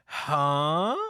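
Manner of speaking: loud, drawn-out rising huh; acted bafflement. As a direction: play it stunned and clueless. Denji's baffled reaction, retold.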